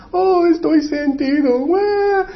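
high, whiny mock wail; put-on high sulking cry; mock self-pity. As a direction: wail like an offended child. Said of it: "decayó su semblante".

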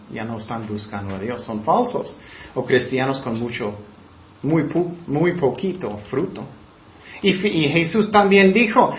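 usual speaking voice

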